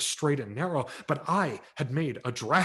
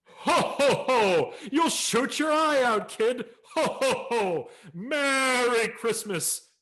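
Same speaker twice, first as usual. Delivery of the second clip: loud, much higher Santa with laughter; laughing Santa; 12 semitones higher, 6 dB louder, slower than usual. A narrator's reading. Santa dismissing the boy down the chute, laughing boom. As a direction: boom it out while sending him off.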